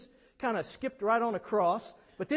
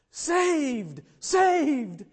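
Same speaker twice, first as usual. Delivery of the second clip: raised, ringing cry; triumphant shout; 6 semitones higher, 5 dB louder than usual. Acted. Preacher voicing believers at the Lord's table, shouting. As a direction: shout it out as one rescued. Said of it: "people who can shout".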